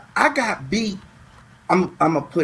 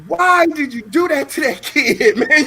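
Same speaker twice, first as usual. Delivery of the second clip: laughing; 6 semitones higher than usual. Real, not acted. Cop asking homeless man.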